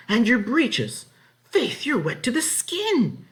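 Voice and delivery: high, loud, slow dismay; crying out. A narrator's reading. Farm wife crying out at Ellen soaked to the skin, high and loud. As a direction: exclaim in shock at the soaked child. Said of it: "she cried".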